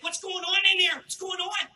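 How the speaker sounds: high-pitched Pittsburgh accent, imitating a tattler; nosy kid in a mock Pittsburgh accent; awful Pittsburgh accent. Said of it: "That awful Pittsburgh accent".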